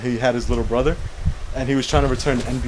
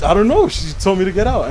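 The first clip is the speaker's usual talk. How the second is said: a high, louder retold outburst; upset outburst; 7 semitones higher, 4 dB louder than usual. Nephew upset telling his uncle he got kicked out, in a high, louder outburst. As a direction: blurt it out, upset and wronged.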